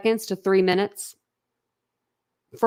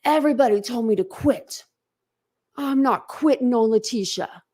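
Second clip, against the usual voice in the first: dramatic lawyer; 3 semitones higher than usual. Retold or imitated. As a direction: ham up the lawyer's big stand. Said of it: "Turned around all dramatic".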